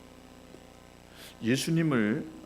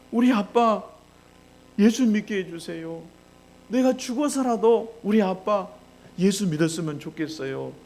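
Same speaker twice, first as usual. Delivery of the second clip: put-on high, loud, impassioned plea in a child's words; child's pleading prayer; 7 semitones higher, 6 dB louder than usual. Child praying in diary for dad's salvation, desperate pleading. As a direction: beg God with mounting urgency. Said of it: "이 아이가 써놓은 일기였어요".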